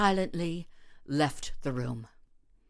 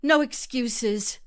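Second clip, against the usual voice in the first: cold; 6 semitones higher, slower than usual. Acted. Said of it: "Vakina stopped him coldly".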